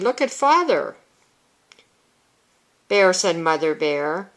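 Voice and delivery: long wrong break after "Father", then "Bear said Mother Bear" run together; misplaced pause. Retold or imitated. Teacher imitating children who don't comprehend, misplaced pause after "Father". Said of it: "children who would read".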